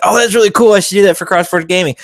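high, loud, excited. Retold or imitated. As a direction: gush about a cool idea. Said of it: "And I was like".

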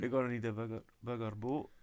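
imitating a botched Scottish accent; off-target Scottish accent. Panellist echoing the student's line, off-target Scottish accent. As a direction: copy the wonky Scottish attempt. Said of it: "it sounds somewhere else".

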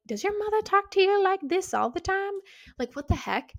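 high incredulous squeal of disbelief; shocked kid. Retold or imitated. Kid asking her friend about her mom in disbelief. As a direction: sound stunned and appalled.

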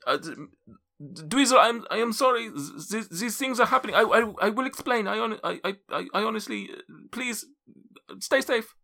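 flustered wizard stammering sorry from upstairs; nervous NPC voice. Acted. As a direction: stutter out a frightened apology. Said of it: "if I can remember how his accent, uh, went".

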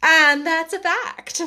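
haughty duchess voice; lofty noblewoman, condescending; imperious plummy grande dame. A narrator's reading. Duchess voice in story.

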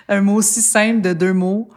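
usual speaking voice

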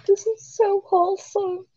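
cooing. Real, not acted. Cooing over cute thing.

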